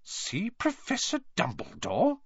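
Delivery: slow, high, incredulous question; suspicious echo. A narrator's reading. Professor repeats the pupils' request, slowly and suspiciously. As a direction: repeat it as if it were very fishy. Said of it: "as though this was a very fishy thing".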